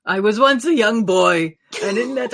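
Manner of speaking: high, loud rousing hero voice; rally them while straining; heroic straining speech. Acted. Ondir holding jaws, speechifying.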